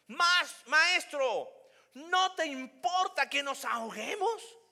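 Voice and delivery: high, slow, loud accusing plea; reproachful complaint. Acted. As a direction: drag out the reproach to the teacher. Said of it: "¿Ves la diferencia acá?".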